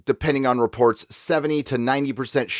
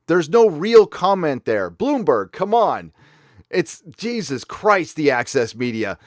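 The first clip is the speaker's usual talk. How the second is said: ordinary delivery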